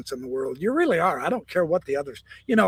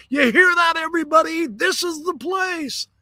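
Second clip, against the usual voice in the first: yelled to the crowd; 12 semitones higher, 3 dB louder than usual. Retold or imitated. A man calling out to the crowd behind him. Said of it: "turned and yelled out to the crowd".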